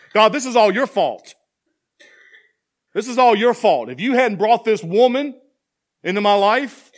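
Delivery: loud, high accusing outburst; furious finger-pointing cry; Adam blaming God. Acted. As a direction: throw the blame at God, shouting.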